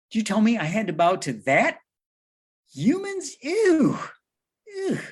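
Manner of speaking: high, sneering devil voice; disdainful Satan. Acted. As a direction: sneer it like a snobby fallen angel. Satan refusing to bow to humans.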